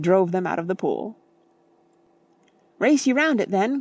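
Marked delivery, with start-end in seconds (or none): 2.8-3.8 excited cry. A narrator's reading. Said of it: "cried Freddy".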